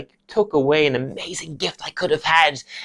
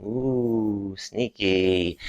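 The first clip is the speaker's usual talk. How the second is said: low, hushed creeping voice; sneaky caterpillar; 4 semitones lower, 7 dB quieter, much slower than usual. Acted. Student playing a caterpillar, low and sneaky. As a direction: creep along conspiratorially.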